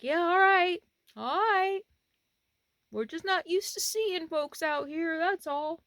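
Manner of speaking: high-pitched calling reply; imitated local stranger. Stranger explaining himself to the camper, imitated local.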